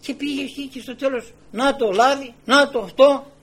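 loud, high pulpit storytelling; full-force preaching. Real, not acted. Preacher recounts Elijah asking the widow for bread, full-force. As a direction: pour out the story with fervour.

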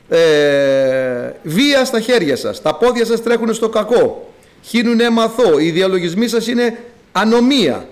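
forceful reading of a prophetic rebuke; preaching scripture. Acted. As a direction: thunder the prophet's list of sins at the flock. Reads prophetic indictment at full force.

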